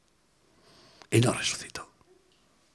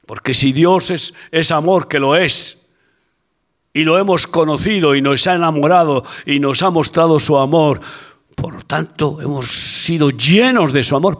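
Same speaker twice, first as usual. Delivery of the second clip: fervent preaching; 3 semitones higher, 7 dB louder than usual. Acted. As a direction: preach it hard to the congregation. Preaching at full force. (predicación enérgica y en voz alta)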